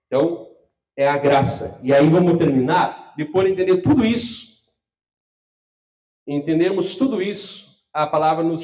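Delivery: loud, emphatic pulpit delivery; preaching at full force. Acted. Pastor closing the sermon to his congregation, full-force loud preaching. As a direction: drive the point home to the whole church.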